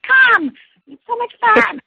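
squealing, hammed-up friend coaxing you along; enthusiastic friend. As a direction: sell it as the best night out. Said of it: "She's like".

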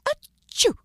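very high pretend "achoo"; spoken-out storybook sneeze; mock sneeze. A narrator's reading. Girl fakes a sneeze to trick friend, high and sudden. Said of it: "letting out a giant sneeze".

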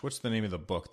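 ordinary delivery

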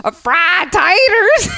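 high, slow, exaggerated country twang; mocking country accent. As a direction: stretch the country vowels way out, high and teasing. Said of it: "I just used your fried taters voice".

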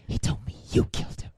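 breathy whispered film line. Acted. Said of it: "acting the scene".

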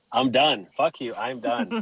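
loud, fed-up outburst ending in a laugh. Retold or imitated. Retelling walking out on the video, fed up and loud. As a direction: slam the door on him, then crack up. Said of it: "And I'm like".

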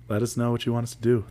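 ordinary talk, nothing marked

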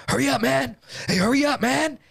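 high-pitched urgent holler; mock impatient call. Acting out Freddie rushing Mark.

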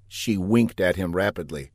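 usual speaking voice

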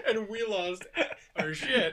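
words pushed out through laughter; trying to talk while laughing hard. Real, not acted. Laughing after story climax.

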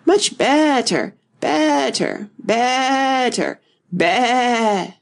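high, drawn-out squeak turning sheep-like; squeak into bleat. A narrator's reading. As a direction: stretch each word up high until it becomes a bleat. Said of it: "her voice rising to a squeak".

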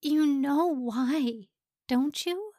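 tender plea. A narrator's reading.

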